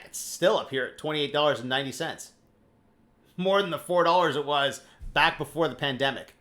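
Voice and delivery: normal talking voice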